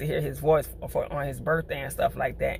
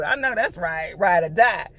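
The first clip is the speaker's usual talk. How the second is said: ordinary talk, nothing marked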